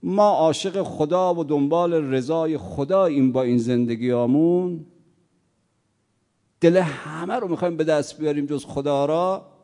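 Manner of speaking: loud impassioned sermon voice; forceful preaching. Acted. Preacher rebuking the congregation, loud fervent preaching.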